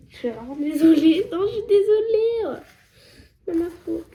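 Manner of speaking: high, loud, overdone sorry voice; mock apology. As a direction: ham up the apology. Excuses exagérées. (excuses exagérées, voix aiguë)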